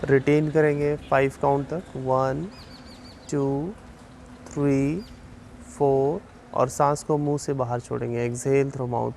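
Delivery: slow, measured relaxation guidance with long pauses; unhurried yoga nidra instructor, slightly softened; guided meditation. Real, not acted. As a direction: guide them calmly through breathing, drawing out counts and cues. Leads a yoga nidra session.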